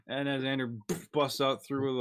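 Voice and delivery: ordinary talk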